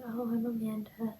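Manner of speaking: put-on lightly Spanish-accented introduction. Flustered girl introducing herself to her crush, light Spanish accent. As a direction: give the name with a touch of Spanish accent. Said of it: "said it with a Spanish accent".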